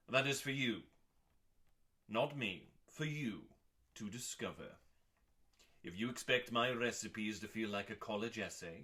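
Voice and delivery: ordinary talk, nothing marked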